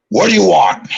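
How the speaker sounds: Vince McMahon. Retold or imitated. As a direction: demand it as an irritated boss impression. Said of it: "Am I getting yelled at for no reason?".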